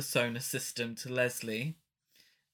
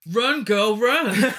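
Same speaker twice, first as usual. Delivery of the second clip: hammed-up, high, loud warning shout; urgent yell; 8 semitones higher, 11 dB louder, slower than usual. Podcaster imitates Leslie warning over the walkie-talkie, hammed-up yell. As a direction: yell it out, on the edge of laughing.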